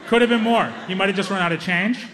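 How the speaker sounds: ordinary talk, nothing marked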